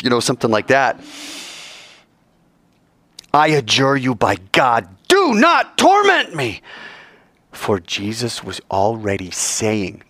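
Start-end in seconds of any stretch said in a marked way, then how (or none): 3.3-6.6 demon voice. Acted. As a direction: plead like a tormented demon, swelling at the end. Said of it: "in the demon voice".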